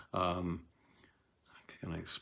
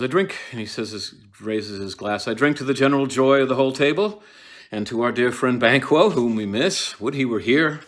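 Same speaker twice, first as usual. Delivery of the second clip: loud forced toast; 4 semitones higher, 10 dB louder than usual. Acted. Macbeth toasts loudly, forced cheer.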